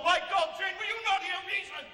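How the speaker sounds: loud, high, exasperated cry at Jane; furious pleading shout; angry outburst. Acted. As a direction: shout it out in frustration. Rochester pleading in anger.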